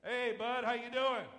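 loud, high, friendly hail across the aisle; calling out. Retold or imitated. Pastor hailing a mechanic in Walmart, loud friendly call. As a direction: call out to get his attention.

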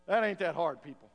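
usual speaking voice

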